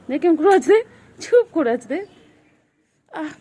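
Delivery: high, loud coo; pet-directed cooing. Real, not acted. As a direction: exclaim over the pet in a high voice. Cooing over the cat. (আদুরে উঁচু গলা)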